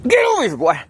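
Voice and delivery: high sharp call to a dog. Real, not acted. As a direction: yell for the dog to come. Calling the dog.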